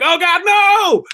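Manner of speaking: high, drawn-out cry of mock horror; mock panicked hornet. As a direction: wail it like a doomed cartoon hornet. Hornet sees dad rolling onto him.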